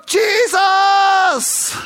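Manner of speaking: high, loud joyful cry of Jesus; excited shout. Retold or imitated. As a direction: burst out in delight. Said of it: "he's so excited".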